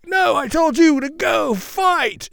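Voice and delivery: high, loud impatient order; a sergeant barking at dim recruits; drill sergeant. Retold or imitated. Drill sergeant scolding his recruits.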